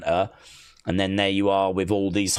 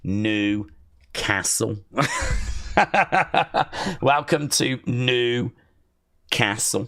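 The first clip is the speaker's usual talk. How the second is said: slow drawl; much slower than usual. Pretend. Drawls the Newcastle joke.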